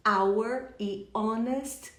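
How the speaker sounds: "hour e honest" slowly, both begun on vowels; drawn-out "hour", "honest", no breath at the start; silent H. Shown as a demonstration. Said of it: "sem H pronunciado".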